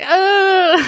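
high, startled uh; a small caught-out noise; child speechless at being found out; stunned child. Retold or imitated. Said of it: "I was like".

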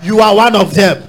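loud, building prophetic declaration; rising to a shouted blessing; fervent preaching. Real, not acted. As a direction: declare it over the crowd at full force. Preacher declares over congregation.

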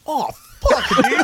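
high, squeaky "dude" exclamation. Acted. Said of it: "That's how they say".

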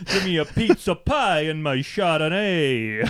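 laughing, hammed-up New York accent; mock New York accent. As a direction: demand it like a cartoon New Yorker, amused. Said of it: "this is insulting to New Yorkers".

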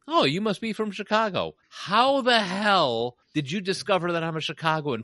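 mock astonishment at the accent; surprised waitress. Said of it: "They'd say".

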